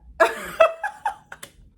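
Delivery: squealing laugh up high; real laughter; high burst of laughter. Real, not acted. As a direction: break into a loud high laugh. Laughing at the story.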